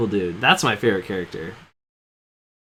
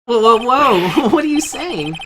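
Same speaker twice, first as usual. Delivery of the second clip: loud, high, drawn-out alarm; shocked protest; 11 semitones higher, 6 dB louder, slower than usual. Acted. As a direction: cut them off in high-pitched disbelief. Acted shock at the accusation.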